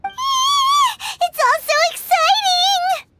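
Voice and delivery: high, slow, gushing excitement; thrilled squeal. Acted. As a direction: squeal it out, over the moon. Thrilled cry at the contest.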